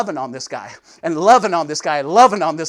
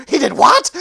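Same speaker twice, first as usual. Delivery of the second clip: astonished wife; 6 semitones higher, 8 dB louder than usual. Retold or imitated. Wife's astonished reply.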